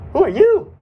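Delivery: high, loud, startled question. Acted. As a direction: blurt it out, caught off guard. Startled by an unexpected visitor.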